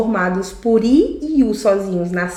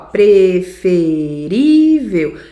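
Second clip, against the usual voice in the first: Preferível said very slowly and louder, stress on ví; slow emphatic demo; 2 semitones lower, 5 dB louder, much slower than usual. Shown as a demonstration. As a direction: stretch out Preferível to mark the strong syllable. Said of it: "Penúltima acentuada". (Preferível dito bem devagar e mais alto, marcando ví)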